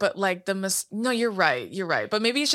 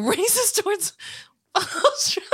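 incredulous, higher, breaking into a laugh; laughing through words; 13 semitones higher than usual. Real, not acted. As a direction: laugh your way through the question. Laughing at absurd accusation.

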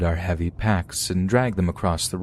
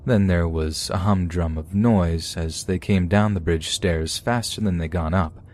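usual speaking voice

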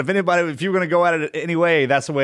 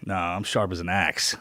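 under his breath; 6 semitones lower, 6 dB quieter than usual. Retold or imitated. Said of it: "under his breath".

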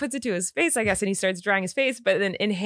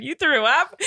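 laughing, higher-pitched retold question; incredulous repeat; 4 semitones higher, slower than usual. Wife repeats husband's words into his silence, incredulous. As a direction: repeat it back in disbelief, half laughing. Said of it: "I repeated the sentence you had said".

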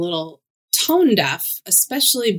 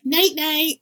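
mock sweet goodnight; 9 semitones higher than usual. Mock husband putting her to sleep.

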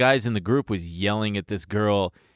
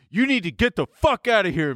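much higher, commanding; retold order; 10 semitones higher than usual. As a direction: order him off the street. Ordering drunk guy to leave.

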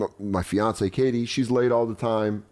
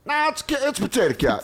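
high mock [name]; bad acting. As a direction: insist on the wrong words. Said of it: "He goes".

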